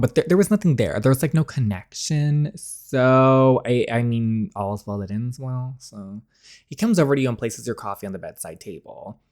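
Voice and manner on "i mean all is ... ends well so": usual speaking voice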